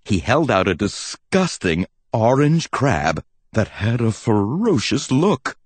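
ordinary delivery, nothing marked

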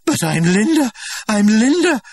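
cling to your name; desperate cry. A narrator's reading. Linda clinging to the director, crying out desperately. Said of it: "Desperately she clung".

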